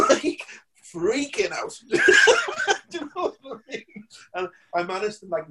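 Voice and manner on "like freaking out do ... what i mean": laughing. Real, not acted. Laughing at his own panic.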